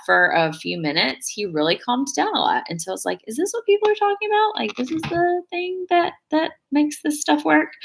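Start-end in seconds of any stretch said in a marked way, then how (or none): 3.3-7.7 much higher, questioning voice; retold wondering realization. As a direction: replay a puzzled discovery in a high pitch.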